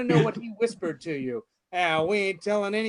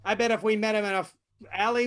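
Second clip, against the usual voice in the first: ordinary talk, nothing marked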